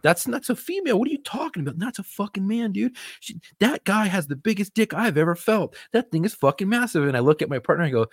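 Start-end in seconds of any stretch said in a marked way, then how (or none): 0.0-7.1 high, frantic disbelief; panicked disbelief. Retold or imitated. Officer reliving his shock at the station in a high, frantic voice. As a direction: shoot way up in pitch like your world just flipped. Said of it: "I was like".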